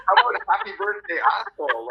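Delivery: a hammed-up jab at an old friend; mock exasperated retort. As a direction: fire it back with a laughing mock scold. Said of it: "I'm like".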